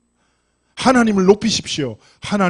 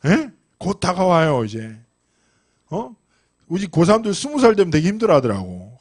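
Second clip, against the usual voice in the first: normal talking voice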